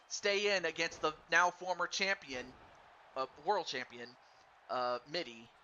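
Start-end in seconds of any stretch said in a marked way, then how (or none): none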